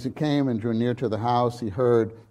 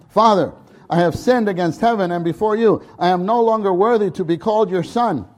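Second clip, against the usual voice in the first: rehearsed apology; 5 semitones higher, 6 dB louder than usual. Retold or imitated. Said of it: "He had this practiced, rehearsed".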